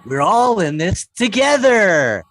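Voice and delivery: loud, drawn-out mock ad slogan; hammed-up commercial voice, stretched final word; pandemic commercial slogan. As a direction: proclaim it slowly like a sappy advert. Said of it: "we used to hear in commercials".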